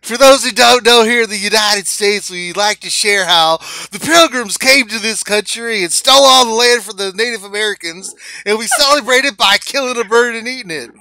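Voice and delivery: "Hi, everybody", big and loud like a TV host; announcer voice. Pretend. Host opens the Meta Report for stream viewers in a loud announcer voice. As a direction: play the cheery segment anchor introducing the show. Hosts a mock news segment.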